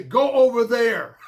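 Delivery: quoting a saying with a slow, amused chuckle; mock saying, laughing. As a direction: toss it off through a laugh, slowly. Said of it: "Go, as they say".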